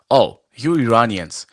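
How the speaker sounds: ordinary talk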